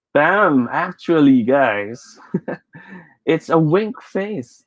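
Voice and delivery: normal talking voice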